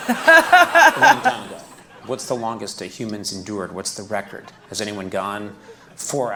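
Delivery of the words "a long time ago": loud high laughing words. Real, not acted. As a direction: laugh through it. Laughing bursts out.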